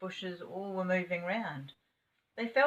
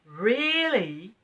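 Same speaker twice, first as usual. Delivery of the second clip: alarmed; 6 semitones higher, 10 dB louder than usual. Acted. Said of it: "said Bessie in alarm".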